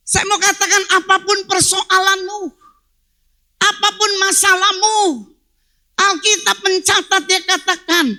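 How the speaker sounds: loud, emphatic sermon delivery; forceful preaching. Real, not acted. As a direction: preach it out to the congregation.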